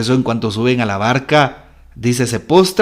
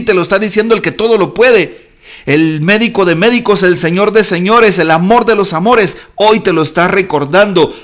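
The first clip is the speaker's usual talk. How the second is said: high, loud, rising sermon build; preacher urging the faithful not to fear; fervent preaching; 5 semitones higher, 6 dB louder than usual. Acted. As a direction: preach with full conviction.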